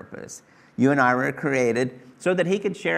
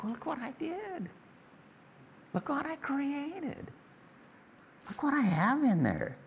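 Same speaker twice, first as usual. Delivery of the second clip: proud hush; 13 semitones higher, 8 dB quieter than usual. Retold or imitated. Proud parent gazing at a sleeping child, high soft wonder. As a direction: marvel quietly at your own creation.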